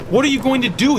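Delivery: ordinary delivery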